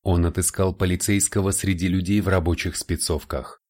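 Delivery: normal talking voice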